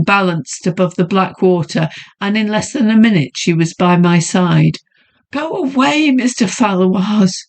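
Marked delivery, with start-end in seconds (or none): 5.3-7.5 breathless, high pleading woman; panting woman. A narrator's reading. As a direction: push the words out, panting. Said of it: "she panted".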